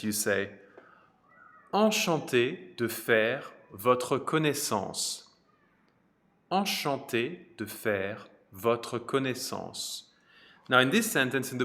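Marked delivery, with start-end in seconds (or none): 1.7-10.0 Enchanté de faire votre connaissance, each syllable slow and clear; slow French model phrase. Shown as a demonstration. Teacher modelling Enchanté de faire votre connaissance for students, slow and clear. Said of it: "If you want to say".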